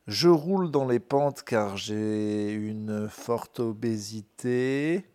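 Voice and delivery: ordinary talk, nothing marked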